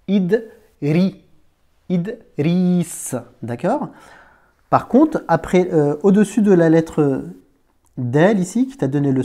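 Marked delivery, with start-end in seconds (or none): 0.1-3.2 Idri, then Idriss, split after Id with long I; sounded out. Shown as a demonstration. Teacher points out the vowel I, saying Idri and Idriss in pieces. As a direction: break Idriss after the d and hold the second I. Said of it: "tu as bien le son I".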